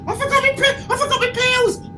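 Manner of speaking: high, frantic repeating; panicked repeat. Retold or imitated. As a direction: stammer it out in panic. Repeating panic, son looking over.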